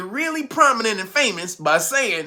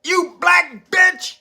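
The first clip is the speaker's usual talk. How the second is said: mock furious yell; 10 semitones higher, much slower than usual. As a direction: yell it in mock outrage, slowing at the end. Voicing enraged critics.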